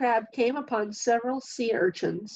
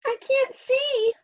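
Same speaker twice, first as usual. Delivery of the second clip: much higher, slow tiny snail voice; little snail voice; slower than usual. A narrator's reading. The snail in the dark forest, high and slow. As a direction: squeak it out like a small lost snail. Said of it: "said the snail".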